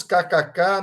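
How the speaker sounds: normal talking voice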